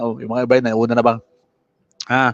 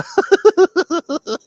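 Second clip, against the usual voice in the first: high burst of laughter; 5 dB louder than usual. Real, not acted. Host laughing at comments.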